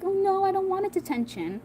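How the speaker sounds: high, whiny imitation of crying; mock crying. Little sister told she could get detention, high mock-crying whine. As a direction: put on a high, wailing complaint. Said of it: "she starts crying like".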